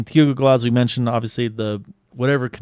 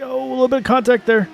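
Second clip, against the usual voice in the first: surprised; 13 semitones higher, 5 dB louder, faster than usual. Real, not acted. Sudden contact during close battle.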